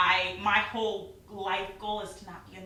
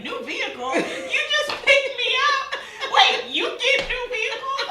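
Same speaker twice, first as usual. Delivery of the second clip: much higher, louder, laughing squeal; shocked disbelief; 12 dB louder than usual. Retold or imitated. Learning friend has new car, laughing shriek.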